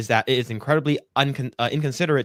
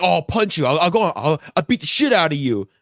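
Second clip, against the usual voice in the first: mock tough threat; 4 semitones higher, 4 dB louder than usual. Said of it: "acts very masculine as well".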